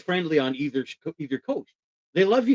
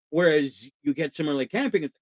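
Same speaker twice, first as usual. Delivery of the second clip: normal talking voice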